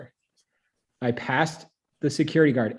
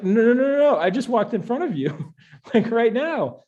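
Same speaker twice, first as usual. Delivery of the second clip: much higher and louder, frantic no; frantic denial; 10 semitones higher, 5 dB louder than usual. Retold or imitated. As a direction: beg him to believe you, laughing at the end. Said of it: "And I was like".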